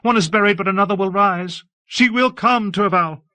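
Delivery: high, loud tearful cry. A narrator's reading. Abbess warning Torval that another will come, crying. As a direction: wail it out in high bursts. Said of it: "cry in sobs and gulps".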